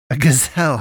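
a narrated outburst; outraged cry. A narrator's reading. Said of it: "I cried, outraged".

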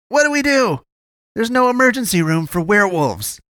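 very high, exasperated argument; countering. A narrator's reading. Friend rejecting help for a werewolf, countering. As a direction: argue back at a high, agitated pitch. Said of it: "Gunnar countered".

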